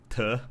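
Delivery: "te" as a short, reduced "tuh"; reduced vowel. Shown as a demonstration. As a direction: clip "te" down to a schwa, "tuh". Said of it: "so when I speak".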